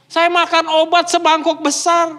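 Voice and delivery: high, loud comic protest; exasperated complaint. Retold or imitated. Diner facing a big bowl like medicine, loud exasperation. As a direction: cry out the suffering in mock misery.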